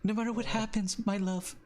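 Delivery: high-pitched, loving voice; Danny. Acted. Danny reassuring Voldo that he is a hero, high and loving. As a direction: lift the voice high for a fond reassurance. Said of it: "she goes".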